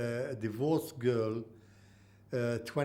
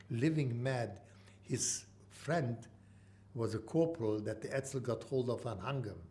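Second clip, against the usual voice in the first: ordinary delivery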